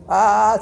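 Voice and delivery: a big, loud, wide-open "Ah"; loud ENT "ah". Shown as a demonstration. Teacher shows students the throat-exam "Ah", loud and long. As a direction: belt out "Ah" as if the doctor is checking your throat. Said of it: "te dice, te pone baja lengua".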